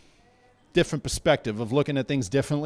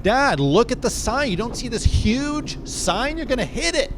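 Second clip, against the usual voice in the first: high, loud panicked warning; yelling warning; 8 semitones higher, 5 dB louder than usual. Retold or imitated. A child in the car yells a warning at his speeding dad, loud and high. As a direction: shout to make him see the sign. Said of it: "I yell at him".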